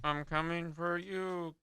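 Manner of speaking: slow, soft robotic monotone; flat robot voice, quiet and slow. Acted. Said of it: "talks like a robot".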